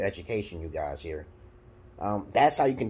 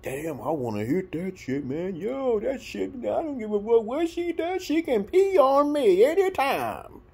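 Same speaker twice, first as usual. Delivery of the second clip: mocking beta guy; 5 dB louder than usual. Mocking the beta guy who will say anything to hit it. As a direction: lightly mimic a lustful dude bragging. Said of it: "beta alphas will be like".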